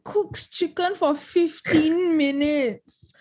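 laughing disbelief. Real, not acted. Mocking the raw chicken recipe.

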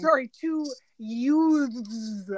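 slow, drawn-out Brooklyn delivery; Vinny's Brooklyn accent. Retold or imitated. Fan quoting Vinny to the judge, mock Brooklyn accent. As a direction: stretch the word out in broad Brooklyn. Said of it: "That's how Vinny says youth".

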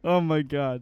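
normal talking voice

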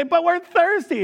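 high, petulant whine; whiny complaint. Retold or imitated. Israelites complaining to God yet again, high petulant whine. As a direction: whine it like a pouting child.

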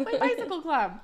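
laughing mockery. Real, not acted. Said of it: "So tame".

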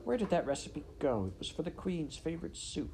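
sleepy mumble. Acted. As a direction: mumble as if asleep. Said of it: "she mumbled in her sleep".

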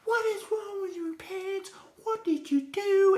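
mother scolding her son over cut-up pants, high and slow; livid mom. Retold or imitated. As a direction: play the outraged mother demanding answers. Voicing his livid mom.

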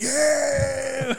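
mock crowd's loud, high cheer. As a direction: imitate a whole tour group shouting in delight. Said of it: "all of them went".

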